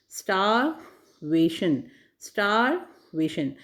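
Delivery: "Starvation" said slowly, pressing the "वे" after "star"; stress demonstration. Shown as a demonstration. Teacher showing students where stress falls, slowly. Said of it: "यानी जब हम व पे starvation".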